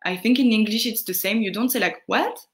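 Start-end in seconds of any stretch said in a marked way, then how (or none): 2.1-2.4 a blunt, abrupt What? that rises sharply at the end; rude what. Shown as a demonstration. Teacher demonstrating an impolite What? to students. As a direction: snap out a careless What? as a bad example. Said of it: "kind of, uh, not polite".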